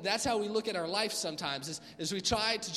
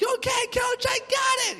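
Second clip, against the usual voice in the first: very high, loud shouted reply; player yelling back; 9 dB louder, slower than usual. Retold or imitated. Player shouting back to his coach, very high and loud. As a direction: bellow it back to the coach, all in.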